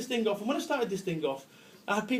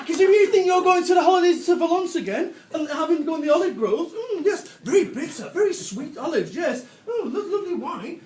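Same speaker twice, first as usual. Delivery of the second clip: mock posh; 6 semitones higher, 6 dB louder than usual. Mocking posh holiday talk.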